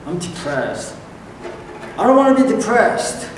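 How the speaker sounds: high, fast whine about being angry; whiny self-talk. Retold or imitated. Preacher imitating a listener's whiny self-talk. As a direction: complain like someone fed up with their own anger. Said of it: "Have you ever done that?".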